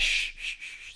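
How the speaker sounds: soft unvoiced shush. Streamer hushing his chat about the Ireland chant, mock secretive. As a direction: hush the chat playfully. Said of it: "Say it quietly".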